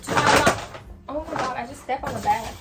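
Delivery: usual speaking voice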